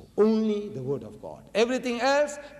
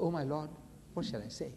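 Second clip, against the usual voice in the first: hushed inner prayer; 10 dB quieter than usual. Retold or imitated. Prophet silently asking his Lord.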